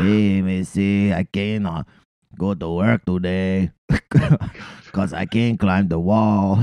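slow, drawn-out put-on Mexican accent; lazy Mexican accent jalapeno. Mocking a ventriloquist's jalapeno puppet, slow put-on Mexican accent. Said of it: "a lazy jalapeno that talks in a Mexican accent".

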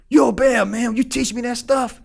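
high-pitched imitation of an eager inmate; eager knucklehead. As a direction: ask like an excited knucklehead. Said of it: "one of the main knuckleheads".

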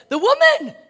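high, loud accusing cry; blaming shout. Retold or imitated. Adam blaming Eve to God with a loud accusing cry. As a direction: blurt out the blame. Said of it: "the man said".

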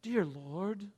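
put-on hushed, pious Old English voice; renaissance fair olde speech. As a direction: pray like a medieval churchman. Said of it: "have a certain voice".

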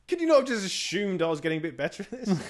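loud, high-pitched indignant protest; mock offended. Friend retelling a slight from a French coworker, mock offended. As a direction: protest loudly, laughing at your own outrage.

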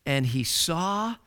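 a soft, dropped hush at the story's end; hushed, slow close. A narrator's reading. Climax of John's story.

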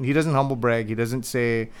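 ordinary talk

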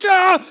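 short high, loud "ah" yelp; retold scream. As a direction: cry out in sudden terror. Retold scream while running downhill.

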